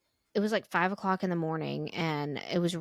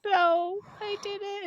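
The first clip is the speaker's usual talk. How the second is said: higher, insistent denial; higher protest; much slower than usual. Retold or imitated. Mom protesting to her daughter, voice pitched higher. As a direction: squeak the denial up high. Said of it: "I was like".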